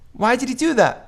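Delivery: a hint of a wounded child's question; mild imitation of a crying kid; hurt little kid. Crying kid asks his friend.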